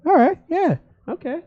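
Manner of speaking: high, surprised voice of the bar guys. Retold or imitated. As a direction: squeak it out like caught-off-guard guys. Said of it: "And they were like".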